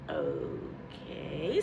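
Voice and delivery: very low, soft, stunned okay. Retold or imitated. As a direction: deflate as it sinks in. Stunned reaction.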